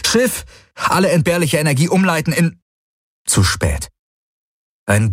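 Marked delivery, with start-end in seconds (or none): 0.1-2.6 high-pitched urgent order; urgent command. A narrator's reading. Pilot orders ship to divert power, urgent command. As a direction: command the ship under pressure.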